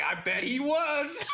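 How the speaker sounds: high, slow, said through laughter; laughing tease. Retold or imitated. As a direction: chuckle out the jab. Inmates teasing, laughing while speaking.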